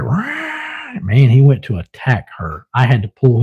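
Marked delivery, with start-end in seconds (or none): 0.0-1.0 high, softer yowl; imitated animal snarl. Owner imitating his pet going after her, mock snarl. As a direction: let out a high mock attack cry. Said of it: "he was just like".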